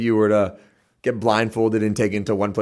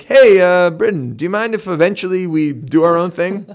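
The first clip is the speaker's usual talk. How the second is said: higher, louder, overly sweet asking permission; mock polite Canadian; 9 semitones higher, 7 dB louder than usual. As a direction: play the timid, courteous Canadian asking Britain nicely. Acting out polite Canadians asking Britain.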